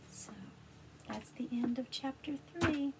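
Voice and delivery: ordinary delivery, nothing marked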